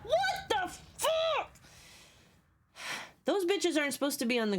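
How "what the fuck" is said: high, stretched disbelief; shocked outburst. Real, not acted. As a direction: drag it out in shock. Shocked in game.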